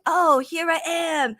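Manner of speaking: lightly hammed-up troll popping in to gloat; Stephen Farmer. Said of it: "he'll pop up and be like".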